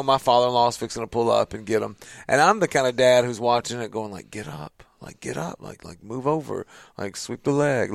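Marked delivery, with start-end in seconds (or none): 4.3-7.9 put-on quietly egging the boys on; under-the-breath coaching; muttered coaching. Dad quietly coaching fighting sons.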